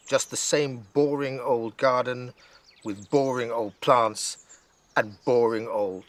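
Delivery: slow, weary drawl of boredom. Acted. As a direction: stretch every boring like a fed-up kid. Nora bored in garden.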